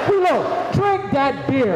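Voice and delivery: mock-bossy shout at a friend; retold shout. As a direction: yell the name, then slow the order right down like a bossy big man. Dave ordering Wilo to drink.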